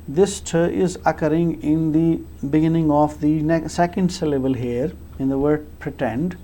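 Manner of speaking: usual speaking voice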